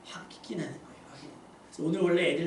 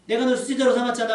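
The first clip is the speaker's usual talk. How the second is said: louder, higher reproachful outburst; hurt angry rebuke; 5 semitones higher, 7 dB louder than usual. Pretend. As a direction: scold a betraying friend. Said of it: "서운함을 막 얘기하신 것이 아니에요".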